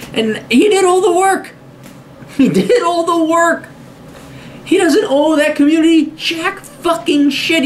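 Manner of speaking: high, drawn-out emphatic rant; indignant insistence. Real, not acted. Man defending a pro athlete's earnings, indignant insistence. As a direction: hammer each word at a high pitch.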